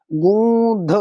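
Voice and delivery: teacher stresses गूंध with a clear dh ending; correct pronunciation. Shown as a demonstration. Teacher showing students the right word, गूंध with dh. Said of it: "इस तरीके वाला".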